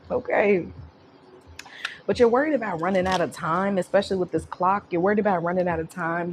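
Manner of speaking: usual speaking voice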